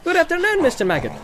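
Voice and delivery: high-pitched, cheery greeting; courteous hobbit caller; polite young hobbit Pippin. Acted. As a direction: greet the farmer brightly, pitched well up.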